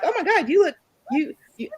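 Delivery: 驚く人々の口まね、高めに; amazed. Retold or imitated. People amazed at her looks.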